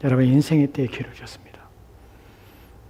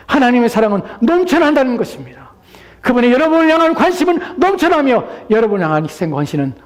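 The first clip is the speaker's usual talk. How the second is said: high, loud exhortation; full-force preaching; 10 semitones higher, 8 dB louder than usual. Acted. Preacher urging listeners to come to Christ, full-force preaching. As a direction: proclaim it urgently at the top of the voice.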